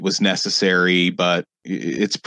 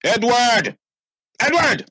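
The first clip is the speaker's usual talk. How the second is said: calling out. Acted. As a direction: bellow for the butler across the house. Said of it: "he starts calling".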